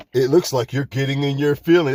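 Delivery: smug commenter's taunt; a hint of a mocking commenter. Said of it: "That's another one they pull off".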